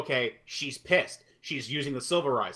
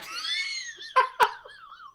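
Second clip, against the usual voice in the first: shrieking laugh. Real, not acted. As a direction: burst into a howling laugh. Howling at the bad line.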